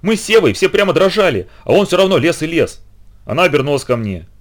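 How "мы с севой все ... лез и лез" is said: higher, excited telling; захлёбываясь. A narrator's reading. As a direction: gush with admiration. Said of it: "захлёбываясь, рассказывала Литка". (взахлёб, восторженно)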